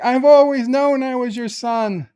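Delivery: high, loud hammed-up elation; overjoyed outburst. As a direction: burst out as if a lifelong hunch is confirmed. Wesley learns captain is his father.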